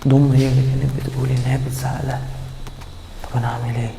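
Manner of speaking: whispered to himself, soft; muttering in despair alone; confused whisper. Acted. Husband torn between mother and wife, whispering.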